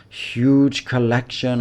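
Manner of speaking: "huge" lifted and slowed; "huge collection", huge stressed. Shown as a demonstration. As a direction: lean on "huge". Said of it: "I can stress the word".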